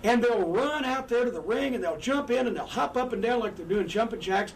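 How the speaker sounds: usual speaking voice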